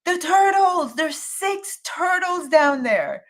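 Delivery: insist, fed up, that they are turtles; exasperated insistence. Retold or imitated. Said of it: "He's like".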